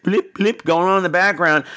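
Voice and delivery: ordinary talk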